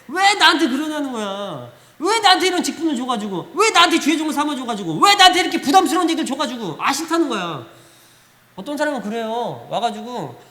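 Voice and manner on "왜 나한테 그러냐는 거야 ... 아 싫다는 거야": loud escalating whining protest; a believer loudly refusing the burden; mock complaint. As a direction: build the grumbling louder each line.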